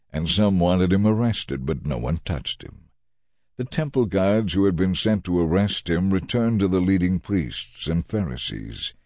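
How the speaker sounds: ordinary delivery, nothing marked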